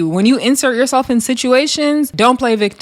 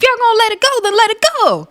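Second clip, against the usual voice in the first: much higher-pitched mock yelling; Samuel L. Jackson yell; 13 semitones higher, faster than usual. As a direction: imitate her hollering, played for laughs. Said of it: "sounding a little bit like Samuel L. Jackson when she was yelling".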